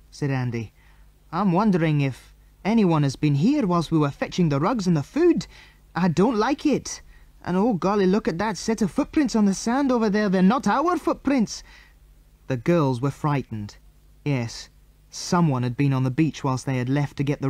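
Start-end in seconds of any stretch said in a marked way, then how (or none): 1.3-11.6 uneasy, climbing into high alarm; growing alarm. A narrator's reading. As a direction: voice rises as the worry grows. Andy alarmed by strange footprints.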